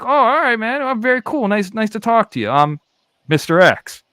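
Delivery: high, bright-eyed fake cool; hammed-up cool voice; mock cool guy. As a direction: play it up as the unimpressed cool guy. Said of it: "I'm like".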